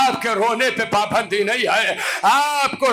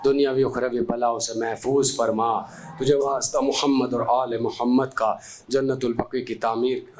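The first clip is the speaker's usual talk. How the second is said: much lower and softer steady supplication; closing prayer; 9 semitones lower, 5 dB quieter than usual. Acted. Preacher leads the congregation in the closing prayer, hushed. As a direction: settle into a hushed, humble dua.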